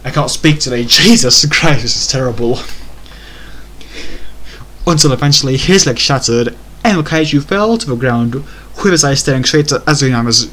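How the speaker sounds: usual speaking voice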